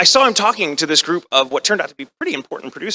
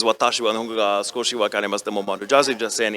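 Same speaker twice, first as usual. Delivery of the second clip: quick, softer run-through; polite Japanese recital; 4 semitones lower, 6 dB quieter, faster than usual. Retold or imitated. Actor replays his formal Japanese phrase, quick recital. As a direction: rattle off the formal phrase softly. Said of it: "this wonderful phrase".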